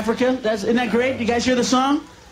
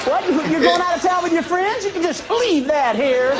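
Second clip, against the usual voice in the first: wife's voice; 8 semitones higher than usual. Retold or imitated. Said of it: "She'd go".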